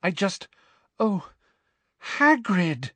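high, faltering horror; horrified. A narrator's reading. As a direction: break off in shock at the sight. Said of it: "continued to gaze up at him in horror".

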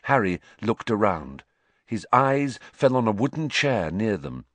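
usual speaking voice

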